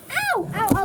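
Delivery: high sharp ow; pained yelp. Real, not acted. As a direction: yelp in pain. Kitten scratching her.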